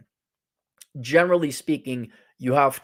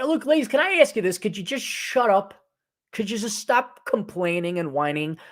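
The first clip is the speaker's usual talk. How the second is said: exasperated scolding; 6 semitones higher than usual. Real, not acted. Scolding women who complain.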